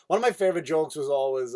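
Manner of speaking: ordinary talk, nothing marked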